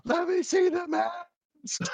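high, very slow rock-singer impression. Singer asking to see the masks, high drawn-out impression. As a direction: stretch out a high stage call. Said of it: "he's doing all the Ozzyisms".

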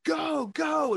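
imitating the crowd's alarm, high mock shout; mock urgent yell. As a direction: hurry them along in a shrill panic. Crowd urging them into shelter.

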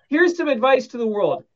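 loud, high-pitched, emphatic public warning; mock dire warning. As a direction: proclaim it to the world as hammed-up advice.

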